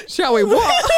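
half-laughing incredulous retort; laughing disbelief. Retold or imitated. Woman retelling the minivan proposal, laughing incredulous retort. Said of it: "And I was like".

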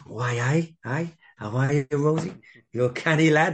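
lilting, affable imitation of a manager greeting a young player; John Neal. Acting John Neal's friendly greeting.

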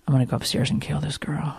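sinister little whisper, all in jest; whispered mock threat. Mom joking about the nanny who made her son cry, whispered threat.

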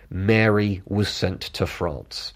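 ordinary talk

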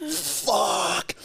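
high-pitched, agonised swearing; retold pain cry. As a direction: re-enact crying out as the needle goes in.

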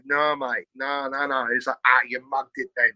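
imitated Essex lad covering up; Essex accent. Friend covering up at the party, Essex accent. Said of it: "that's a great accent".

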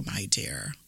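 menacing whispered my dear. Acted. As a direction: breathe my dear as a hushed whisper. Wolf disguised as granny.

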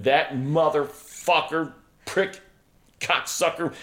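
friend's all-caps texts, read as mock fury; reading angry texts aloud. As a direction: spit out the insults as in caps texts. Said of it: "they were all in caps".

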